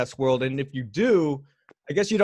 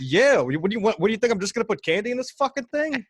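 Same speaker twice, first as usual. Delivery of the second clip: high-pitched mock disbelief; incredulous comeback; 8 semitones higher, faster than usual. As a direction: retell it as an indignant, isn't-it-obvious retort. Retold incredulous comeback to guest.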